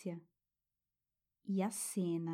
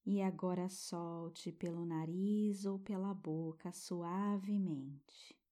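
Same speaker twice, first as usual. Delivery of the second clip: guided meditation. Acted. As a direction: soothe a child toward sleep.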